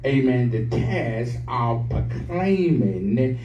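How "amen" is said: ordinary talk, nothing marked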